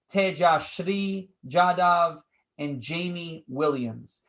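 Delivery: usual speaking voice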